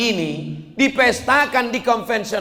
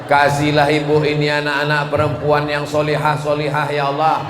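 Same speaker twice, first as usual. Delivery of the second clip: prayer; 7 semitones lower than usual. Acted. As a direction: pray aloud over the congregation. Preacher prays for the mother.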